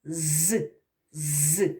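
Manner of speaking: З held as a long buzzing zzz; isolated sound. Shown as a demonstration. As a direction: sustain the З as a hissing buzz for the class. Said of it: "Який перший звук?". (протяжний звук «З», низько й тихо)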